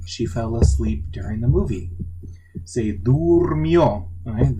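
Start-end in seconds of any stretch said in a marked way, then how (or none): 2.7-4.1 Se durmió much slower, higher and louder, each syllable spelled out; slow emphasis. Shown as a demonstration. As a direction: stretch Se durmió out to show the U. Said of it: "The O has changed to a U".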